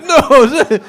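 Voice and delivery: high, loud protesting cry; mock upset cry. Acting out an upset stranger, loud protest. Acts upset at being compared.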